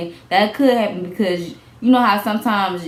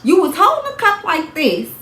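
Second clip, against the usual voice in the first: imitating judge; 6 semitones higher than usual. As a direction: grill her like a skeptical judge. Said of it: "They gonna be like".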